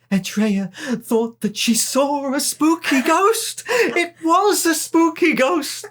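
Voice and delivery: high, slow, terrified reading; scared narrator voice. Retold or imitated. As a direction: read it like you're frightened. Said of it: "by sounding scared all the time".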